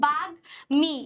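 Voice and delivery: বাগ্মী broken as bag, then mi with m sounded; syllable-by-syllable demo. Shown as a demonstration. Teacher shows how the word is pronounced, syllable-by-syllable demo. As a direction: split বাগ্মী into two separate parts, bag-mi. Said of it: "এভাবে উচ্চারণ করতেছি".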